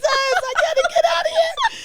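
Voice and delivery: high, loud panicked outburst; hammed-up desperate wail to escape; comic panic. Retelling her panic at Stedman, a high comic cry. Acts own panic at being seen.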